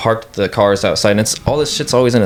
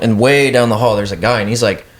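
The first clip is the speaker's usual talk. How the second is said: normal talking voice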